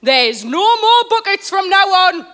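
loud, high, stern ruling; loud stern announcement. Acted. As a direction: lay down the law to the room. Club steward laying down law.

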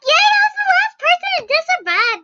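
high loud victory scream; triumphant shriek. Real, not acted. Kid screaming in triumph at winning the round. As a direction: shriek with joy.

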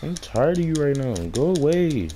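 annoyed telling-off. Real, not acted. Telling monster off.

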